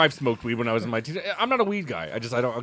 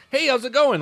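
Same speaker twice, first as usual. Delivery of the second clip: high sing-song salesman hello; cheery booth greeting; 10 semitones higher, 4 dB louder than usual. Retold or imitated. Greeting passers-by at the convention booth, high and cheery. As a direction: chirp it like a booth vendor. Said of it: "you're like".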